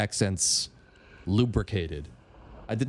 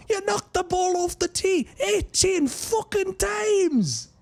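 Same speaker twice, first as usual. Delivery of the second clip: a much higher, slow, hammed-up Scottish golfer rant; Robin Williams Scottish; slower than usual. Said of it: "Robin Williams doing".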